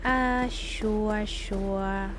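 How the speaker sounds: low, drawn-out grudging agreement; retold reluctance. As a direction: give in grudgingly, stretching it out. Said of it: "so I was like".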